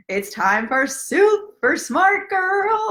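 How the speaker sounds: high, slow announcer intro; over-the-top show intro; superhero announcer. Pretend. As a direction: trumpet in the hero like a cartoon narrator. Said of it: "better shtick for that one".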